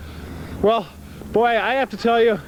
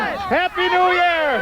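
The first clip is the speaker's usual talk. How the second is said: cheer; 6 semitones higher, much slower than usual. Acted. New Year countdown cheer.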